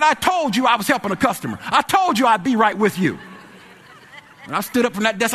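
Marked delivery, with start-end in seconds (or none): 0.0-3.2 high-pitched, loud scolding; angry retelling. Retold or imitated. Clerk scolding an impatient man mid-call, angry and indignant. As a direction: re-enact an exasperated telling-off. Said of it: "that made me mad".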